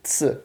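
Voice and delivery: "Ts" as T and S joined; a short isolated "Ts" consonant; ts sound. Shown as a demonstration. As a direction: say "Ts" as in pizza. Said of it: "como um T S".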